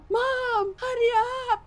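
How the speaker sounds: high, loud tearful cry of hurry up; crying plea for help. Retold or imitated. Daughter crying to her mother on the phone, tearful plea. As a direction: beg through sobs for someone to come.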